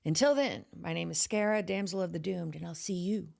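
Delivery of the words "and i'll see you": slow eerie whispered sign-off; spooky whisper. Acted. Horror host whispers her catchphrase goodbye to viewers. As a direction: breathe it out like a creepy secret.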